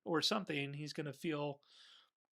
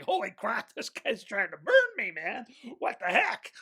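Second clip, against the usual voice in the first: outraged monster; 7 semitones higher, 9 dB louder than usual. Retold or imitated. Monster reacting to the torch.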